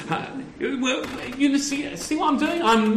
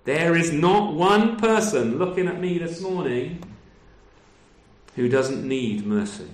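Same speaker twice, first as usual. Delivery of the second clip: usual speaking voice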